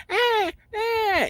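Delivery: very high newborn wail; imitated baby cry. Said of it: "The baby crying".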